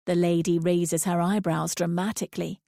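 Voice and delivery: plain reading voice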